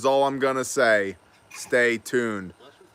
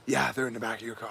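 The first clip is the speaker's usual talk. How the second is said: whispering; 7 dB quieter, much faster than usual. Real, not acted. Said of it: "Why are we whispering?".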